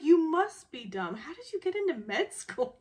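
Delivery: loud, high exasperated insult; acted-out frustration. Frustrated man insulting her in a quarrel, exasperated. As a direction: hurl it back, pitch leaping up. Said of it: "He's just frustrated at this moment".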